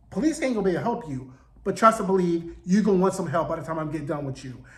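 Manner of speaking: fast, rushed mock threat. Morpheus threatening the writer Rick, fast mock menace. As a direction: rattle off the warning at speed. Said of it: "he was like".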